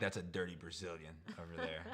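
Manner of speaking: a low muttered snobby jab; a mock snob's put-down. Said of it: "I'm like".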